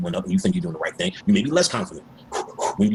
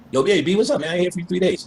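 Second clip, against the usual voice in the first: casual hype greeting; 9 semitones higher than usual. Retold or imitated. Calling a friend casually.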